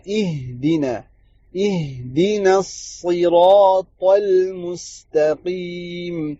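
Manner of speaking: اہدنا الصراط المستقیم very slow with a still ha and thin noon; correct recitation. Shown as a demonstration. Teacher reciting the full verse correctly, very slow. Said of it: "صحیح سے ساکن کرنا ہے".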